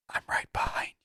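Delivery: soft breathy whisper, menacing; hushed spectral threat; creepy ghost whisper. Retold or imitated. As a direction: whisper it like a ghost at your back. Ghost voice heard in game.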